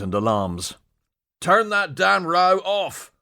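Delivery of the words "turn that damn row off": shouting over noise. Acted. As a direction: yell over the alarms. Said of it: "Douglas shouted above the din".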